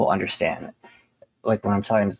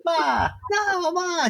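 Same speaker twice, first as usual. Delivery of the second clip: mock Italian son; much slower than usual. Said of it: "And he's like".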